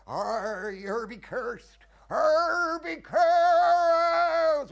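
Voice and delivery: high, slow, building bellowed curse; cursing old prophet. Retold or imitated. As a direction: wail the curse like a mad old seaman. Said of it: "be this awful old man that warns them".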